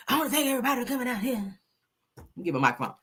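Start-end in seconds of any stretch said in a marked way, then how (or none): 0.1-1.6 fast, high, soft preacher imitation; Eddie Long. Host mimics the preacher greeting church, high soft put-on voice. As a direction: mimic a frail preacher in a small, quick voice. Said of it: "That was Eddie Long".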